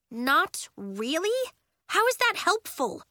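put-on exasperated kid, rising in disbelief. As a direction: throw her words back at her. Nori exasperated at Willa.

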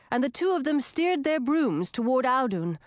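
usual speaking voice

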